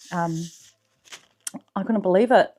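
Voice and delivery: ordinary talk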